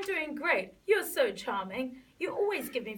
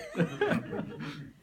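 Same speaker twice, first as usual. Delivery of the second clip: laughter; 4 semitones higher than usual. Real, not acted. Group laughing at scene.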